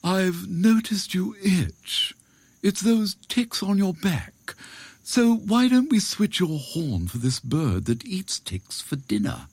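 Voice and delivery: high-pitched, coaxing jackal; sly jackal. Acted.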